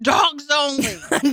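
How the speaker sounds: mock shout. Joking callout on podcast.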